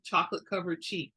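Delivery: ordinary delivery, nothing marked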